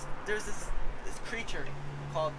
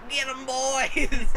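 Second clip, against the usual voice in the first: put-on slow, high Boston-accented rally cry; 9 semitones higher, 5 dB louder, much slower than usual. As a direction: shout it like a Boston tough guy. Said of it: "like, a Boston accent".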